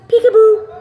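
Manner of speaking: high, loud peekaboo; playful peekaboo. Real, not acted. Owner playing peekaboo with her bird, high and loud.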